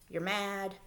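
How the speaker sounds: ordinary talk, nothing marked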